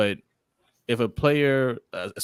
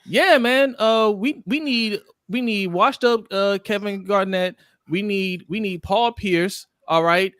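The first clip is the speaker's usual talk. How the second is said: higher, louder eager GM pitching a trade; over-eager GM voice, slowed on the names; mock GM; 8 semitones higher, 5 dB louder, slower than usual. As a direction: ham up a desperate general manager. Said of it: "Sometimes the G M says".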